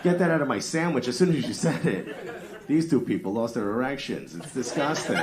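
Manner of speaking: playful theatrical grossed-out rant; mock disgust. Real, not acted. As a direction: groan in fake disgust. Mock disgust at Milwaukee provolone.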